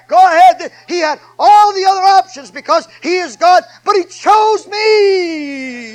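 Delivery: high, loud preaching building to a drawn-out shout; full-force preaching. Acted. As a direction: build to an impassioned pulpit climax.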